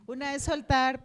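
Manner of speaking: saying soltar through a real laugh. Real, not acted. Laughs while repeating.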